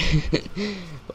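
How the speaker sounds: real, high-pitched giggle; amused laugh. Real, not acted. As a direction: break into a short giggle. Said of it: "I'm still laughing right now".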